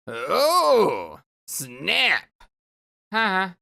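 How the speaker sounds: improvised weepy sprite voice. Acted. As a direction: make up a whiny ghostly sprite voice. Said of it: "I'll just go with it".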